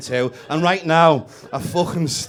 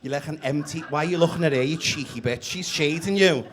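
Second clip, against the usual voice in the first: usual speaking voice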